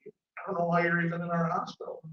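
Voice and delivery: imitating an upbeat doctor, high and loud; doctor's voice. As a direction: deliver good news as a hearty doctor. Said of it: "And he goes".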